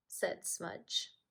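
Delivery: ordinary delivery, nothing marked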